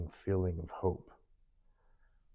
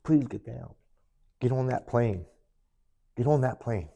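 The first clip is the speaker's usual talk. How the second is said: pleading; 4 semitones higher than usual. Retold or imitated. Wife pleading with suicidal husband.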